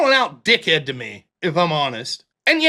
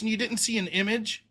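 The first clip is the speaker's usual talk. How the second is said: usual speaking voice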